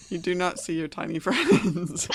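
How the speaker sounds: loud, laughing through the words; real laugh taking over by the end; breaking into laughter. Real, not acted. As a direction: deliver bad news while cracking up. GM breaks up delivering bad news.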